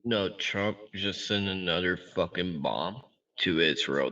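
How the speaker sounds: ordinary talk, nothing marked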